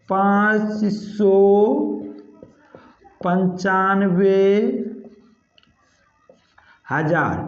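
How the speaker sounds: slow, word by word with long pauses; slow dictation. Shown as a demonstration. Teacher dictates the number to students while writing, slow. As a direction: spell out पाँच सौ पंचानवे हज़ार slowly while writing. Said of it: "हज़ार यहीं पे लिख देते हैं".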